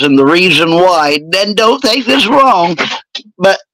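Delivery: ordinary talk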